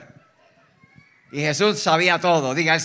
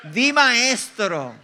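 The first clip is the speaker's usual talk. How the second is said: very slow, mock-reverent drawl; exaggerated bootlicker, higher than usual; two-faced hypocrite; 4 semitones higher, much slower than usual. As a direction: flatter the teacher with stretched-out words. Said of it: "hijo de su mamá".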